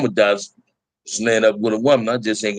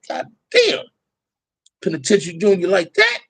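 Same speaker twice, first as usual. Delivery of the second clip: high, stunned "God damn" that trails into disbelief; shocked exclamation; 8 semitones higher than usual. Retold or imitated. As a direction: gasp it out like you can't believe your eyes.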